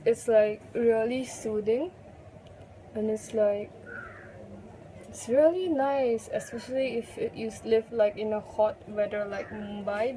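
lips stiff under wet sheet; limited mouth movement; through a sheet mask. Real, not acted. Seen 0: Wet sheet mask covers face and mouth area throughout the labelled words.

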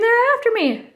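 high, urgent acted cry; storybook scream. A narrator's reading. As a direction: narrate a frightened scream without full volume. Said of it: "she screamed".

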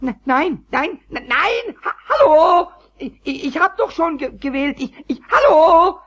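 high, loud, near-crying protest; frustrated wail; desperate shouting. Acted. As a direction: shout into the phone on the verge of tears. Desperate to be understood.

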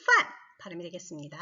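ordinary talk, nothing marked

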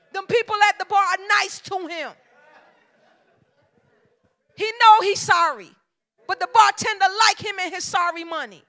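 loud, rising preacher cadence to the church; full preaching. Acted. Preacher exhorting wives in the congregation, loud and driving. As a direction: preach it hard, calling the women to build their men.

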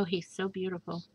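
ordinary talk, nothing marked

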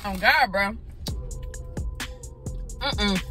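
ordinary talk

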